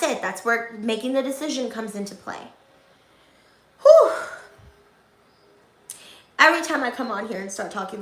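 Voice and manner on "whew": loud high whew. Real, not acted. Exhale after intense pep talk.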